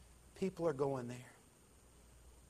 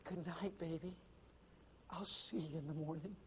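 whispered farewell; 3 semitones higher, 7 dB quieter than usual. A narrator's reading. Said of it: "she whispered in his ear".

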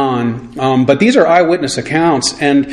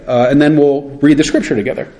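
ordinary talk, nothing marked

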